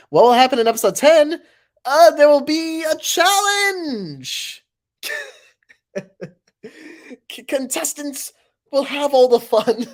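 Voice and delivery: loud high hype announcer. Acted. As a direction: hype it like a TV trailer. Hyped announcer preview.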